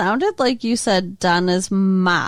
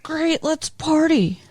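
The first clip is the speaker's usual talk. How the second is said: imitating Steve; 6 semitones higher, slower than usual. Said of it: "Steve's like".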